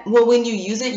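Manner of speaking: ordinary talk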